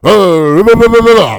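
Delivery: loud, high babbling non-answer; mock confident blather. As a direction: parody a speaker blustering past the question. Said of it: "but they speak with such confidence".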